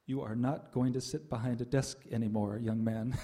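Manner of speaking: low, firm pronouncement of an inner guru; Swami's inner voice. Retold or imitated. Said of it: "I heard Swami say inside".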